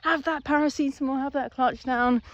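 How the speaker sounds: very high-pitched, hammed-up comforting voice; over-the-top cheery consoling, starting loud; mock reassurance. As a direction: soothe the car like a panicking pal, squeaky and playful. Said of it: "you pat it on the back, and you're like".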